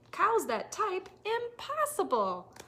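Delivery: high, loud disbelief; shocked farmer. A narrator's reading. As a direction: exclaim in total disbelief.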